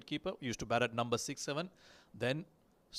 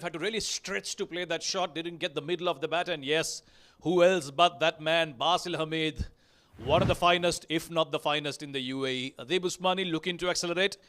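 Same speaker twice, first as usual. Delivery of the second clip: raised, excited play-by-play; higher-pitched, urgent live match call; live cricket commentary; 5 semitones higher, 5 dB louder than usual. Real, not acted. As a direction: call the wicket as it happens, pitched up and punchy. Live play-by-play as wicket falls.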